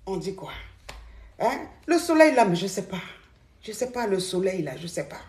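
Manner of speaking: drained, hungover and quiet. Real, not acted. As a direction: speak like your head is pounding. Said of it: "J'ai la gueule de bois".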